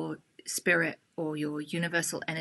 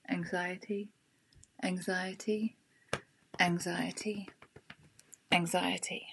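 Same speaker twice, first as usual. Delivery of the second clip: repeated word with a small rise, fading softer; rhythmic repetition trailing to a whisper; tapping repetition; 7 dB quieter, slower than usual. Shown as a demonstration. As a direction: let each one drop quieter. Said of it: "tap along with me and repeat what I say".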